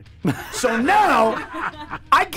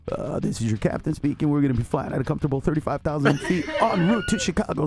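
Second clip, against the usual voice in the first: lower, fast pilot-announcement voice, chuckling through it; airline captain voice; 9 semitones lower, faster than usual. Retold or imitated. Comic doing the captain voice for the hosts, low and fast. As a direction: play it like a captain on the intercom. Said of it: "all captains sound kinda like this".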